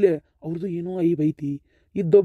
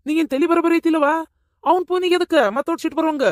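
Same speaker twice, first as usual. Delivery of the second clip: exasperated scolding; 7 semitones higher, faster than usual. Acted. Scolding the old mother.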